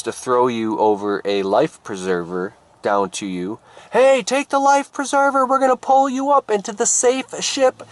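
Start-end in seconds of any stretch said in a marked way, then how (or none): 3.9-7.8 very high-pitched rescuer's call. Acted. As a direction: holler from the boat to someone in the water. Rescuer calls to drowning swimmer.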